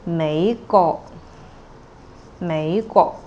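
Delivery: "美國" said with a rise then a mid level tone; Cantonese tones demo. Shown as a demonstration. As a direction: say "美國" rising, then middle. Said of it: "rising and middle sound".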